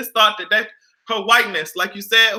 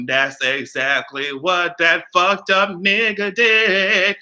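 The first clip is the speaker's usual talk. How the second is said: usual speaking voice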